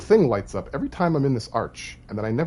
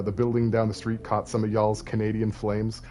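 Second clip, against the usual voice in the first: ordinary delivery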